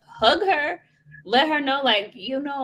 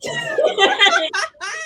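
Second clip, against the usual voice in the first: 아주 높게 터지는 비명 같은 웃음; shrieking laugh. Real, not acted. Laughing outburst.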